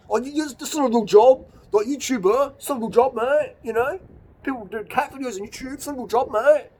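high, loud, sneering put-on voice; mocking a sneering critic. Aping critics who sneer at his YouTube job, high and loud. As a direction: ridicule the hater by aping him.

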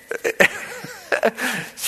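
a short, breathy chuckle; quick embarrassed laugh; sheepish laugh. Real, not acted. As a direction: laugh at yourself. Laughing at his own panic.

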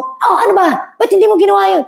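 mild hammed-up irritation; mock scolding. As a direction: play the stressed parent snapping at the kids. Mock scolding the helper and kids.

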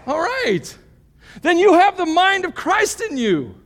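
high, loud preaching voice driving each point home; full-force preaching. Acted. Pastor preaching the fruit of the Spirit, at full force. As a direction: preach it out big to the whole room.

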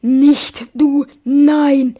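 loud, gloating, full of hate; hateful gloating. Acted. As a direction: crow over him with hatred. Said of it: "rief sie voller Hass".

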